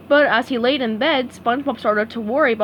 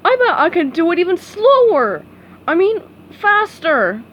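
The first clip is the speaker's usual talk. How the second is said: Patrick voice; 7 semitones higher, 6 dB louder than usual. A narrator's reading. Patrick boasting, self-correcting.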